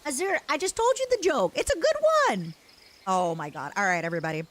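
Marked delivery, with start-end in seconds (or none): 0.0-2.5 indignant. Real, not acted. Insisting her joke was good.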